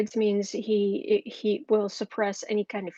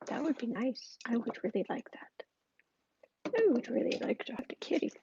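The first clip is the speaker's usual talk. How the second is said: hushed whispery pet talk, softer; soft cooing to cat; 5 dB quieter than usual. Real, not acted. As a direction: coo gently to the kitty in a near whisper.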